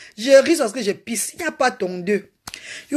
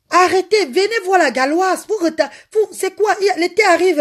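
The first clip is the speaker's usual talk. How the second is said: excited calling; 8 semitones higher, 3 dB louder than usual. Real, not acted. Excitedly calling the girls to look.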